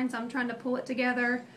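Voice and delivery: ordinary delivery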